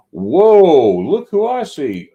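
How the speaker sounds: loud, high whoa of surprise; excited greeting. Real, not acted. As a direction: greet a friend with a big, high shout. Excited greeting of arriving guest.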